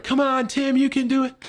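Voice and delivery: high-pitched calling up; cheering on. Retold or imitated. Friends down below urging him to jump, cheering on.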